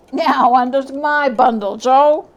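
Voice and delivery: loud, eager call to Joe; a sharp-tongued thief showing her loot; loud old woman calling; charwoman calling out. Acted.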